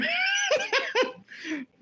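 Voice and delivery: very high peal of laughter; laughing. Real, not acted. Woman cracks up about camera-shy cousin, high laughter. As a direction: burst out laughing, high-pitched.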